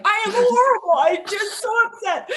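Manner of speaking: high, loud hammed-up wail; mock bawling. Bawling to the cab driver who asked how she was, hammed-up sobbing. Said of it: "I'm bawling".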